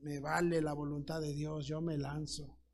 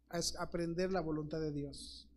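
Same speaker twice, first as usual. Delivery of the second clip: usual speaking voice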